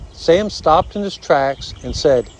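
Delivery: plain reading voice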